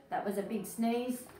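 ordinary delivery, nothing marked

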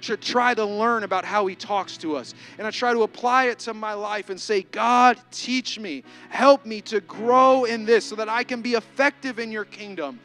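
loud, rising sermon voice with heavy emphasis; full-force preaching. Acted. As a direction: preach it out to the congregation.

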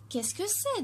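high, wondering little voice; a small drop asking in wonder; a child's curious question. Acted. Curious droplet asks about city.

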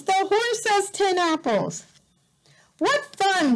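high, happy storybook voice; cheerful reading. Acted. Parent reads to a child, cheerful and high. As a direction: delight the little listener.

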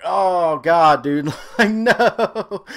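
dismayed outburst building to a high no; shocked disbelief. Retold or imitated. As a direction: replay the gut-punch moment. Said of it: "I was like".

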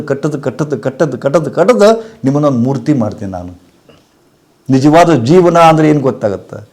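ordinary talk, nothing marked

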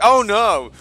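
hammed-up cry of dismay; mock alarm. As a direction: imitate someone suddenly freaking out. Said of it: "He's like".

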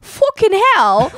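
loud, slow, high British "Fucking hell."; mock British accent. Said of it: "mit dem Akzent sagen".

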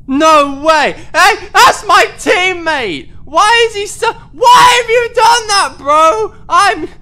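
real outraged wail at teammate; outraged shout. Real, not acted. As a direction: scream in disbelief at the betrayal. Teammate ratted him out.

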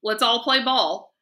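scornful, slow and loud; disgusted sneer. A narrator's reading. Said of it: "snorted in disgust".

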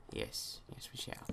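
whispered playful reply; mock whisper. Gamer answering himself in a playful whisper. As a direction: hush it like a secret.

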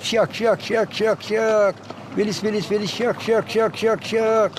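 slow, drawn-out bird notes; like a plaintive birdsong from an hour away; mournful nightingale şakşak. Shown as a demonstration. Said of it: "sanki bir saatlik yoldan ses geliyormuş gibi hazin". (hazin bülbül şakşağı taklidi)

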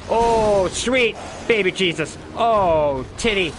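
normal talking voice

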